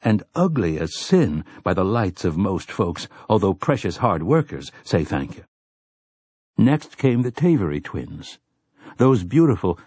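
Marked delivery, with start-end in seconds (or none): 4.8-5.4 murmured response. A narrator's reading. Crowd murmur in story.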